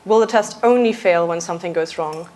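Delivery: normal talking voice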